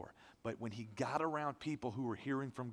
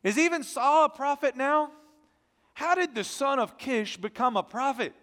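high, loud, astonished townsfolk; astonished exclaim; 9 semitones higher, 12 dB louder than usual. Acted. Townspeople exclaim in disbelief that Saul prophesies, astonished. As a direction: cry out in disbelief, with a laugh. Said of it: "they exclaimed".

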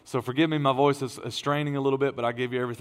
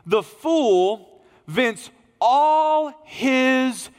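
high, loud, slowed pulpit declaration; preaching at full force; 6 semitones higher, 5 dB louder than usual. Acted.

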